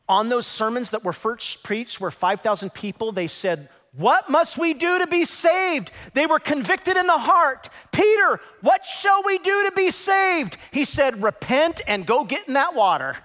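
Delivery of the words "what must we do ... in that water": high, loud pleading sermon voice; full-force preaching. Acted. Pastor retelling the crowd's plea, full-force preaching. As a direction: cry out the crowd's question.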